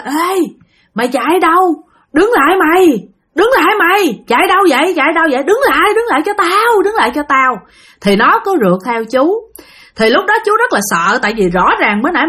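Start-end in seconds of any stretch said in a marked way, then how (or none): 0.0-7.6 ghost yelling after him. Retold or imitated. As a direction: scream at him to stop running. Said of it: "mới kêu rằng á là".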